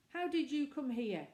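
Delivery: put-on a vicar scolding the children; severe question. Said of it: "he asked severely".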